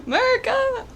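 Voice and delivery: gasp, then shout it out proudly; mock-amazed cry of America; gasping excited exclamation. Shown as a demonstration.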